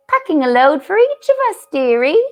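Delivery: cool, slightly Irish-tinged beaver housewife; Mrs Beaver, coolly. Acted. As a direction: answer coolly with a touch of Irish lilt. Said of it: "said Missus Beaver very coolly".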